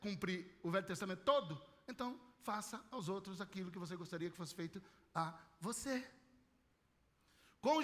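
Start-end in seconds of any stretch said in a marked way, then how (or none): 1.9-6.1 softer, intimate retelling. Shown as a demonstration. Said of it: "repeated golden rule".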